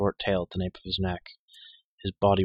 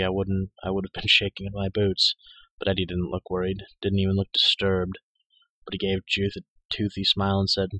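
plain reading voice

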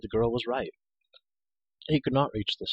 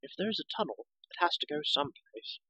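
soft, high, measured reply; patient condescension; 8 semitones higher, 8 dB quieter than usual. Acted. Girl explaining to the ranting hero, softly patient.

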